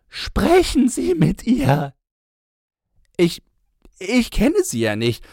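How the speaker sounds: high, loud, anguished begging man; desperate pleading. Acted. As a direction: beg with breaking desperation. Man desperately begs the narrator.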